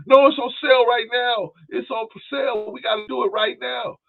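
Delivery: imitating wife. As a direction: play the wife begging to buy it now. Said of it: "My wife will come to me".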